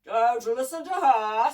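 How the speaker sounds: loud, slow, stern boom; slow, commanding monster; stern gramophone giant. A narrator's reading. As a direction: order it sternly in a big mechanical voice. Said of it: "said the gramophone sternly".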